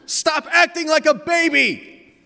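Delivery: high, loud, drawn-out acted scolding; screaming. Older son scolding his brother, screaming. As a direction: scream it slowly at your little brother. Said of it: "[name] screams".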